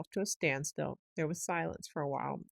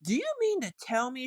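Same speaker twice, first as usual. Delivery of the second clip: higher, louder shout; 7 semitones higher, 5 dB louder than usual. A narrator's reading. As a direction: shout it, thumping the table. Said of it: "shouted the rat".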